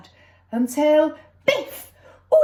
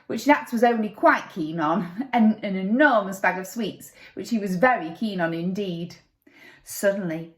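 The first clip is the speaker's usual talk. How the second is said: plain reading voice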